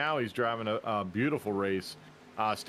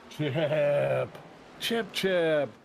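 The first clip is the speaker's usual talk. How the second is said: calling out; 5 semitones higher, slower than usual. Real, not acted. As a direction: sing out his name across the room. Greeting a newcomer, calling out.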